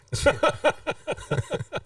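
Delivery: squeaky burst of laughter; high laugh. Real, not acted. Laughing at joke to future self.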